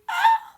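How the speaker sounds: very high, loud fangirl squeal; short piercing squeal of delight; re-enacted squeal. Reader reacting to long live the queen, delighted squeal. Her reaction to a tender scene.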